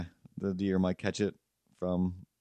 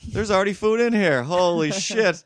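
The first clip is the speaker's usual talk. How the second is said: high, loud voiced cat amazed at food; 9 semitones higher, 5 dB louder than usual. The cat finding food already in its bowl, high and loud. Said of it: "And then he was like".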